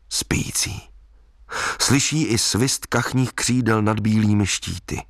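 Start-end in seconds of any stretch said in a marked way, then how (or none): 0.1-0.8 breathy whispered word. A narrator's reading. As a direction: whisper it like not to wake the lake. Sleeping lake, hushed word.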